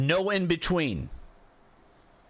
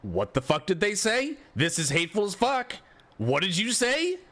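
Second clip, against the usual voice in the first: outraged listener; 6 semitones higher than usual. Retold or imitated. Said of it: "most people would be like".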